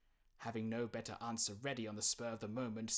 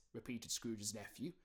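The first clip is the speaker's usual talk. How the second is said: ordinary delivery, nothing marked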